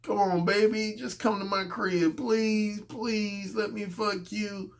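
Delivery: soft, drawn-out pleading; wheedling, stretched-out begging. Acted. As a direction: beg slowly and softly, dragging out each plea. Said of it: "So that's, that's begging".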